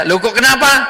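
high, loud startled question; surprised. Acted. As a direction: ask in sudden surprise. Prophet asks the weeping woman.

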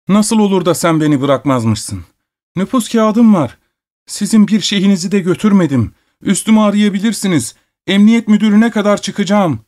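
tiz, ağlamaklı bir sesle bağırarak; sarhoş ve çaresiz, sızlanarak haykır; ağlamaklı bağırış. A narrator's reading. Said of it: "ağlamaklı bir sesle bağırıyordu". (kapıdan çıkmak isteyen müzisyen, ağlamaklı bağırış)

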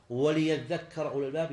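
وليذكر as "wa-li-yadhakkara"; firm "wa-li" opening وليذكر; corrected kasra. Shown as a demonstration. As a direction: sound the short i on the lam of وليذكر. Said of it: "لا، هي بالكسر".

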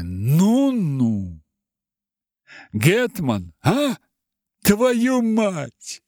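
angry growl, high and loud. A narrator's reading. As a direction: snarl it out furiously. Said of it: "рычал Мышлоевский".

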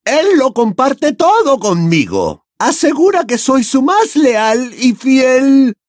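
high, loud boastful villainess; high-pitched, fervent protest; Bellatrix, vehement. A narrator's reading. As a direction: insist forcefully in a high female character voice.

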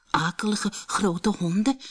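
hushed, frightened utterance; scared. A narrator's reading. Benny dreads the big dogs, scared and hushed. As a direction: half-whisper it in dread. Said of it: "zei Benny bang".

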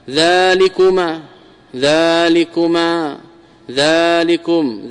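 ذلكما and ذلكم drawn out slowly syllable by syllable; slow careful demo. Shown as a demonstration. Teacher to students, modelling the forms very slowly. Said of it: "لأنك تقول".

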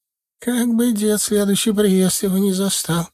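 put-on high, chattering old granny voice; old village woman. A narrator's reading. Старушка в сером платке жалуется на деда, высокий старческий голос. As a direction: tell it like a fussy village grandma.

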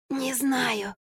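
high, soft strained croak; wheezing. A narrator's reading. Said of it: "прохрипела Марфа". (сдавленный высокий хрип)

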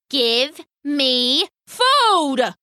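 slow, word-by-word angry bird demand; snapped demand. A narrator's reading. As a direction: demand it slowly, rising high on the last word. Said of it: "snapped the bird".

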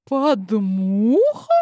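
almost squeaking in disbelief; shocked shrill echo. Acted. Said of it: "Женщина сильно повысила голос".

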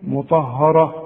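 "مطهره" with thick, dark "haw"; wrong heavy ha. Retold or imitated. Teacher imitating a student's mistake, heavy ha. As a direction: darken the ha of "مطهره" like the ta. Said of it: "دي هتغلط فيها ازاي".

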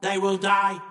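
high robotic monotone threat; cold, droning verdict; menacing Monoid leader. Acted. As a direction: pronounce death like a machine.

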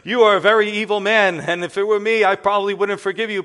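a preacher confronting an evil man head-on; stern rebuke. Retold or imitated. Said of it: "he shook him, he says".

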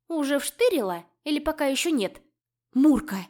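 sharp loud snapping call; growled shout. A narrator's reading. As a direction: snap the name out loud. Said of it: "рыкнула я на неё".

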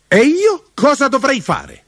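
high, loud indignant question; furious. A narrator's reading. Mudube challenges the old man over his role, furious. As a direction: demand it, outraged, pitch shooting up. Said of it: "Ma Mudube era furioso".

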